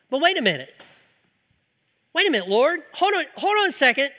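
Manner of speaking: surprised protest. Acted. Preacher protesting as Elijah.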